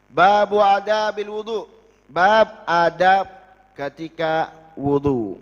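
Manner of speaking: loud, slow reading of a chapter title; reading an Arabic chapter heading to the class; kitab reading. Acted. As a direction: announce the chapter slowly and loudly.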